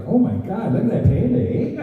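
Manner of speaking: high-pitched, amazed Toronto bystander; Toronto accent. Acted. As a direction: gawk in a hammed-up Toronto accent. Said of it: "people with Toronto accents to be reacting".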